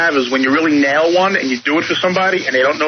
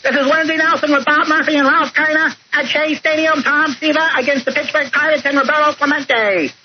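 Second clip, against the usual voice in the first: much higher announcer voice, changed timbre; Lindsey Nelson impression; 10 semitones higher than usual. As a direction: play a pitched-up old TV baseball announcer. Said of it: "I used to do Lindsey Nelson".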